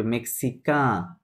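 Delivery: মেক্সিকা slowly, last "kaa" drawn out through the nose; মেক্সিকা slow, falling, final N silent; nasal final vowel. Shown as a demonstration. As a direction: stretch the nasal ending of মেক্সিকা. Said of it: "আপনার nasal sound".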